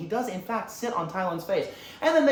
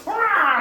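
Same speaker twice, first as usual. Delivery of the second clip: high, loud "aah" cry; short burst of mock fury; imitated battle yell; 14 semitones higher, 8 dB louder than usual. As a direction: yell like a charging film villain. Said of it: "and he goes".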